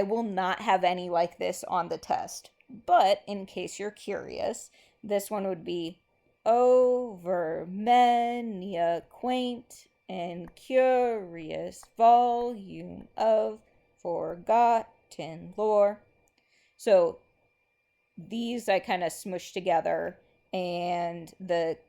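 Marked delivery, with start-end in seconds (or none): 6.4-16.0 slow, rhythmic recitation marking every beat; slow poem reading. Acted. Teacher reads a poem line to the class, slow and rhythmic. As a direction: read it out as if tapping the meter.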